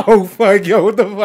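loud, high laughing disbelief; retold shock. Co-host reliving season-ending twist, loud shock. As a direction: blurt it out, hugely amped up.

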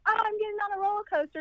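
high, fast squeal of a thrilled crowd; high-pitched mock glee; mock excitement. Mimics excited amusement park crowd.

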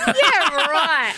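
high-pitched scoffing disbelief; sarcastic disbelief. Real, not acted. Co-host rejecting the news-reading excuse, sarcastic high disbelief.

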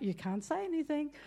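soft teasing warning; mild mock reminder; friends warning. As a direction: hush her gently. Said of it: "they're like".

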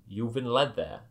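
ordinary delivery

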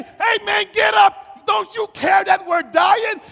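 loud, high panicked yell; frantic, shouted reproach; freaking out. Acted. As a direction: holler to rouse a sleeper. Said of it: "They were freaking out".

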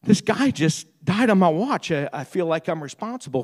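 mock-tearful protest, restrained; retold weeping. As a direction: imitate a grieving friend, lightly tearful. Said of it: "he's crying".

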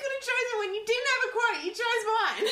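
high, fast, laughing mock outrage. Real, not acted. Friend stole her favourite quote, high laughing mock outrage. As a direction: protest it in mock despair, laughing.

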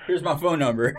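laughing through a mock offer; laughing mock line. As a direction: giggle it out as a joke. Mocking the stalker lady, laughing.

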